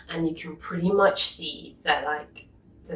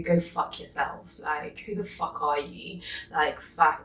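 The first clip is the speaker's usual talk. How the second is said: ordinary talk, nothing marked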